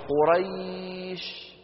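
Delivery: قريش stretched very slowly on its ي; drawn-out easy glide. Shown as a demonstration. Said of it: "شايفين السهولة عند نطق".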